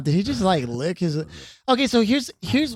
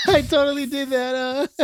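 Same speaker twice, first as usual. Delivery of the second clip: laughing; 9 semitones higher than usual. Real, not acted. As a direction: laugh through the words.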